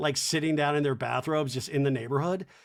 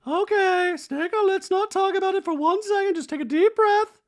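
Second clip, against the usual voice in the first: very high, loud put-on voice; hammed-up imitation of a coaxing housewife; Dorit; 7 dB louder than usual. Dorit telling Kyle to breathe, mock calming. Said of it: "And Dorit's like".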